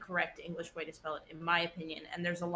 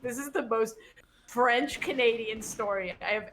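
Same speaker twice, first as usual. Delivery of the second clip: higher, louder emphatic retold reaction; exasperated disbelief; 3 semitones higher, 5 dB louder than usual. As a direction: punch it out, appalled at the story. Retelling her exasperated reaction.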